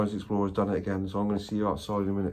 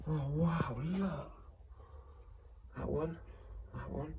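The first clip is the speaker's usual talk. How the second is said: soft whisper of amazement; whispered wonder; 5 semitones higher, 14 dB quieter, slower than usual. Real, not acted. Showing the camera old treasures, whispered wonder. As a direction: breathe it out quietly, awed. Whispering while filming the room.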